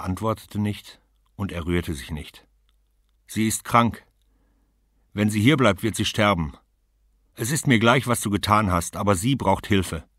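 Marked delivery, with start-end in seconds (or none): 3.3-10.1 loud, pressing demand; urgent plea. Acted. Woman urges the man to help the sick wife, loud and urgent. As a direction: press him hard, no patience.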